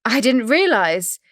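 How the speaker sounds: flustered guest backing off. Acted. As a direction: still rattled and apologetic. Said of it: "seen her flustered".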